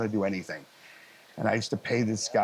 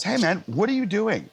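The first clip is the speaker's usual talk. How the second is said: higher, mock startled question; incredulous; 10 semitones higher than usual. Mentor catches young engineer's wrong levels, mock incredulous. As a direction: catch someone mid-mistake.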